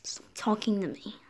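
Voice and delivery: hushed whisper, no voice. Acted. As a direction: hiss "Stop talking to me." under the breath. Whisper then repeat out loud.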